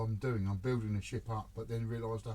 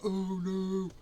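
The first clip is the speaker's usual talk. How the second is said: dismayed oh no; 9 semitones higher, 7 dB louder than usual. Retold or imitated. Mock dismay at imagined disaster.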